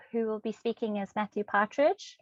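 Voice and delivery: normal talking voice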